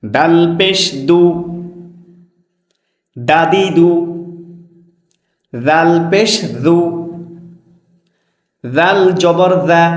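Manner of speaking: slow, measured teacher's chant; spelling drill recitation. Shown as a demonstration. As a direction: recite each letter deliberately, vowel drawn out. Leads a letter-spelling drill.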